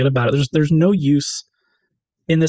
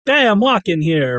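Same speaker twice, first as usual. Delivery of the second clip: mock New Yorker; 7 semitones higher, slower than usual.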